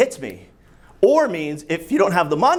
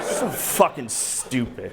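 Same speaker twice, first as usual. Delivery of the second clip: ordinary delivery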